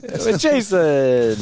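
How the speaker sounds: high, very slow announcer echo. Pretend. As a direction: draw it out like a ring announcer. Echoes in announcer style.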